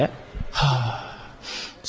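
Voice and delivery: a quick puff of air, no voice; short soft exhale. Shown as a demonstration. Teacher showing short relaxed out-breaths, softly. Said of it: "今度は短い音で".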